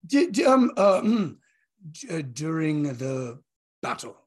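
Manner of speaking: halting, stammering, awkward compliment; trying not to panic. Acted. Player's character praising Tariel mid-ceremony, nervously halting. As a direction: stumble through praise while fighting down nerves. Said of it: "trying his best to not freak out".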